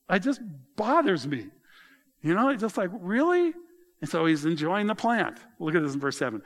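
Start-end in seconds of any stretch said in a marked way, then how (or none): 2.9-3.5 incredulous. Real, not acted. Preacher's disbelief at Jonah.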